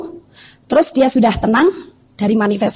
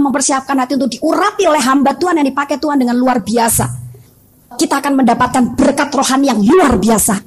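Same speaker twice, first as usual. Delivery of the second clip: usual speaking voice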